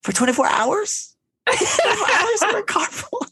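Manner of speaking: very high, squeaky disbelief; mock her outrage with a laughing squeal; squealing exaggerated disbelief; incredulous mock quote. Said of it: "she's like".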